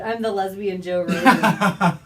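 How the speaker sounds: loud, laughing boast; proud claim through laughter. Real, not acted.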